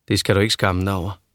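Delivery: normal talking voice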